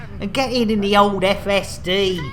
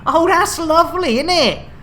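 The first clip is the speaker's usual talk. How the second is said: higher, louder put-out reply; mock indignation; 7 semitones higher, 4 dB louder than usual. Host hearing that Jason has gone off, mock indignant. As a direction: bristle at the news, pitched up. Told crew member has left.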